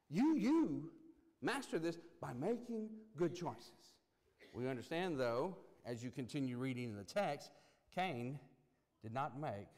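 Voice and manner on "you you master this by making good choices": "you, you" punched high and loud, "master this" slowed and firm; emphatic YOU. Shown as a demonstration. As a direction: slam the doubled "you, you", then land "master this" slowly. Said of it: "it's emphatic when God says".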